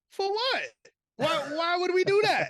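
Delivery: high, loud, hammed-up disbelief; mock incredulous response. Host imitating co-host's response to a Detroit prediction, high loud mock disbelief. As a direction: throw the question back in exaggerated outrage. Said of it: "your response was".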